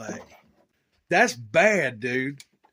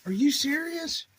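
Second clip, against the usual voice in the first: surprised; 12 semitones higher than usual. Real, not acted. Seeing friend's better truck parts.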